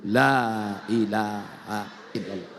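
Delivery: low, drawn-out recitation; slow zikir recitation. Real, not acted. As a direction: stretch it out reverently, sinking at the end. Recites zikir slowly before loud demonstration.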